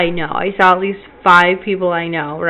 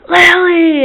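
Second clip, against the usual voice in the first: high, loud scream of a name; retold all-out yell; super loud scream; 11 semitones higher, 6 dB louder than usual. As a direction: scream for your missing child. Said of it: "I scream, like, super loud".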